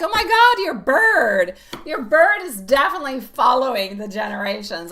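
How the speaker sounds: loud, high, excited exclaiming. Retold or imitated. Telling her mom about the bird, loud and excited. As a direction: blurt it out to her mom, overexcited. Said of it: "I'm like".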